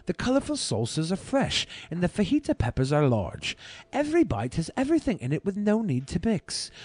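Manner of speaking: measured, deliberate review narration; a hint of a British accent in the read; food review voiceover. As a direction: read it like a taste-test video host. Reads his food review voiceover.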